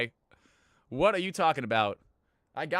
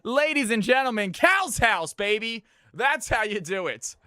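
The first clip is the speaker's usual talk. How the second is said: hype announcer; 7 semitones higher, 6 dB louder than usual. Acted.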